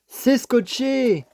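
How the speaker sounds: drawn-out, high dismayed cry; dismayed surprise. Real, not acted. To viewers, finding the box taped, slow dismayed exclamation. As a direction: stretch it out, caught off guard. Finds the package taped shut.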